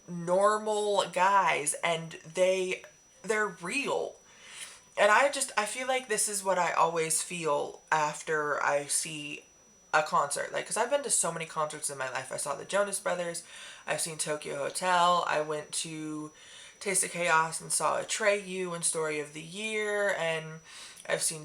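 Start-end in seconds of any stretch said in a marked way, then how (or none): none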